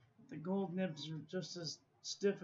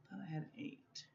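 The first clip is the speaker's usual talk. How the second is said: whispered aside; 7 dB quieter, faster than usual. Real, not acted. Muttering while counting pens.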